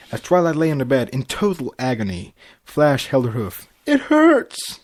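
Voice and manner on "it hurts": high loud cry of pain; wife crying out in labor. A narrator's reading. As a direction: cry out mid-contraction. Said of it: "Twilight cringed".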